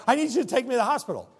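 urgent plea. Retold or imitated. Bleeding friend begging for a ride, fast panic. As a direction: blurt it out in a panic. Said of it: "And you're like".

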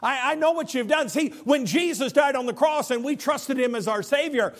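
high, forceful sermon voice; ringing, urgent gospel proclamation; impassioned preaching. Acted. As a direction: proclaim it with full conviction. Preaches forgiveness.

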